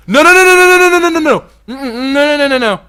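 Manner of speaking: high, loud string of no's; panicked no's. Real, not acted. Player yelling at the beetle going the wrong way, high and loud. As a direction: shout the no's in alarm.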